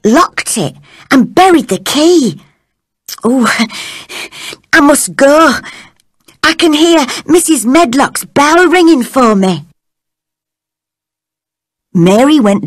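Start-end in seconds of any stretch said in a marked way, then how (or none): 3.2-9.6 put-on higher young girl voice.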